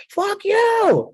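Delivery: very high, drawn-out furious yell; screaming. Retold or imitated. Shady guy back at the agents in the airport, screaming. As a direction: scream back at the top of your lungs. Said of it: "screams at the top of his lungs".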